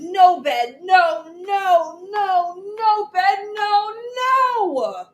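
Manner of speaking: high, loud tearful no; crying refusal. Acted. Child refusing bedtime to Mommy, loud and tearful. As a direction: cry no over and over. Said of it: "I rolled and rolled and said".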